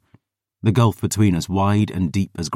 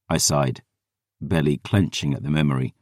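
ordinary delivery, nothing marked

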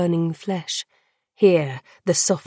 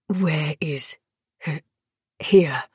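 halting low words, broken by stammer; laboured, pained; 3 semitones lower than usual. A narrator's reading. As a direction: force each word out through pain. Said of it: "inhale as if to ready himself".

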